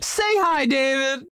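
high, bright sing-song call; excited call. Real, not acted. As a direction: call him in cheerfully. Calling co-host to greet.

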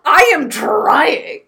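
high, loud and drawn-out; exasperated protest. Real, not acted. Defending own roleplay effort to a friend, exasperated. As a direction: stretch each word in loud protest.

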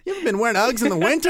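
demanding woman. Retold or imitated. Imitating woman.

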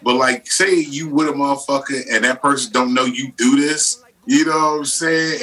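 ordinary delivery

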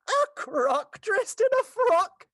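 mock him like a spiteful pack animal; mean hyena voice. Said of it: "That was my hyena for you".

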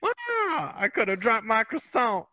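very high-pitched squealed fright; hammed-up high, indignant squeal; mock startled scream. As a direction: shriek it like a spooked man in a viral clip. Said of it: "someone scares him".

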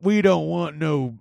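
slow mock cowboy protest; drawn-out cowboy objection; rodeo local. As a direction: echo a disapproving rodeo local, dragging each word.

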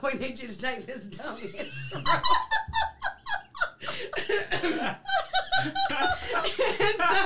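can barely get it out for laughing; laughing through words. Real, not acted. Laughing while telling friends the pilot agreed.

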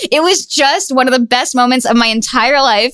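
ordinary talk, nothing marked

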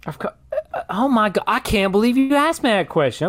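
normal talking voice

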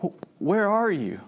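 pained. Acted. God the Father searching for his creation, pained. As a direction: draw the question out in hurt. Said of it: "I can sense his pain".

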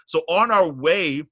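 normal talking voice

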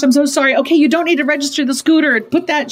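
high, hurried rush to fix it; frantic fixer. Retold or imitated. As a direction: jump in urgently and take over. Retells jumping in to fix daughter's crisis.

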